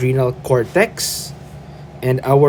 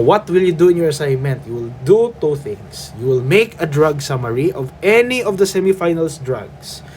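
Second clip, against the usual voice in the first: normal talking voice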